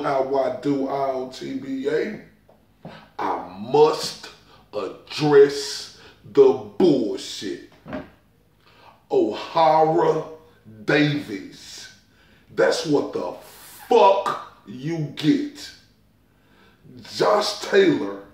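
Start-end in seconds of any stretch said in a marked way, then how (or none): 3.2-11.9 slow emphatic. Acted. 12.5-15.7 slow catchphrase. Acted. Catchphrase drawn out.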